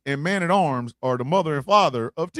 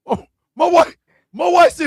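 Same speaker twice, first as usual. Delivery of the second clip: loud, high excited shout; yelling the story out; shouting excitedly; 11 semitones higher, 9 dB louder than usual. Real, not acted. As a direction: burst out loud and high telling it. Excited retelling of wife's outburst.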